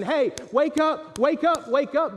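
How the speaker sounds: slow, high, loud call of wake up; Jesus calling loudly to a sleeping soul. Acted. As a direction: rouse a sleeper with a booming call. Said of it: "he's not gonna do it harshly".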